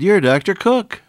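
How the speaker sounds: high, loud retold exclamation, amused; surprised recognition. Retold waitress recognizing him.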